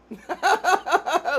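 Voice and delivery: loud, high-pitched burst of laughter; bubbling high cackle; real laugh. Real, not acted. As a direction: crack up suddenly. Laughs at the comment exchange.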